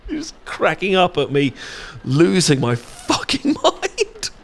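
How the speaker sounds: high, giggling through the words; laughing. Real, not acted. Friend giggling over the wasabi photo, laughing high. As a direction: crack up while you tell it.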